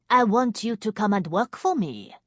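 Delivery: put-on thick French accent. A narrator's reading. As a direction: introduce yourself in smoky French. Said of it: "in a French accent as thick as nightclub smoke".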